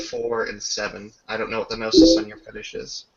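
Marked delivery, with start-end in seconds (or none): none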